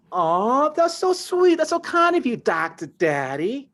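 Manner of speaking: very high, loud, slow seductive coo; flirty dancer. Retold or imitated. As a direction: purr it to lure a customer. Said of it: "And she's like".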